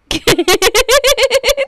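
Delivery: high, loud burst of laughter; shrieking laugh. Real, not acted. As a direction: cackle out loud. Cracking up at the prank.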